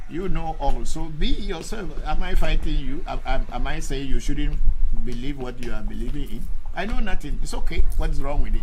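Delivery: loud, heated retort; indignant shouting at the commenter; defiant rant. Real, not acted. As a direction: push back hard at the critic.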